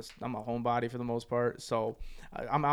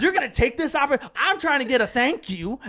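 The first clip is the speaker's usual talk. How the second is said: raised, high indignant complaint; pissed off; 10 semitones higher, 5 dB louder than usual. Retold or imitated. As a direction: retell it as an exasperated rant. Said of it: "I'm pissed as hell".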